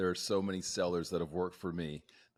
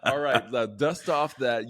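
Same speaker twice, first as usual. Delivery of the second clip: laughing; 3 semitones higher, 9 dB louder than usual. Real, not acted. Host jokes about podcast voice.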